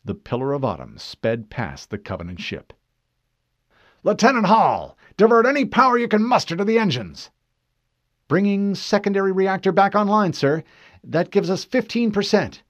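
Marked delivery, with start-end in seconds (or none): 4.0-7.3 loud, high, fast order; forceful, hurried captain's shout; urgent command. Acted. Captain orders escape at full power. 8.3-12.6 high, tense officer's report; raised, alert military voice; lieutenant reporting. Acted. Lieutenant answers urgent order.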